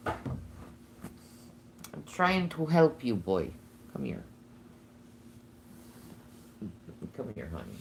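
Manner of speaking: soft, slow soothing coax; tender coaxing. Real, not acted. Owner coaxing Gavin to a warm spot, soft and tender. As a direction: gently coax the pet close.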